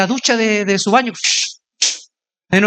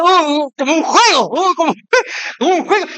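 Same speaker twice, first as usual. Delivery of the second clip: un ligero gemido agudo con risas; imitación burlona; 5 semitones higher than usual. Retold or imitated. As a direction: imita al chico que juega, entre risas. Chico imitando un juego. (imitando al chico con risa)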